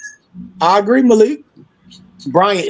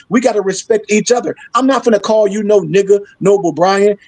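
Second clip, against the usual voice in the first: ordinary delivery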